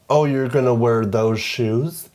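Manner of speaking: put-on catty best friend with American accent; mock American accent. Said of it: "he's not American, he's British".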